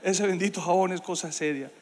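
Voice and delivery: ordinary talk, nothing marked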